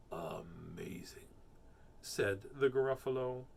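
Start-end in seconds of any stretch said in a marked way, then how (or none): 0.1-1.3 slow, breathy whisper of amazement; awed whisper. Acted. Gruffalo amazed at the mouse, slow whisper. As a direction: whisper it in wonder.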